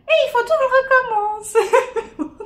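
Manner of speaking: high, slow laughing wail. Real, not acted. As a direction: laugh through the frustration. Laughing despair at redoing video.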